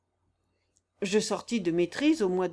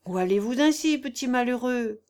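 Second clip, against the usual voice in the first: usual speaking voice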